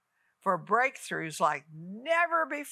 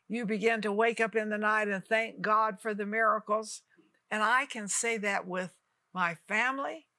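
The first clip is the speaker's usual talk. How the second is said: ordinary talk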